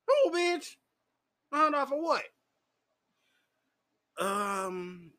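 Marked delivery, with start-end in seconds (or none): 0.1-2.2 much higher, louder "Who, bitch?"; outraged retort. Retold or imitated. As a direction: fire it back in disbelief. Outraged at being charged.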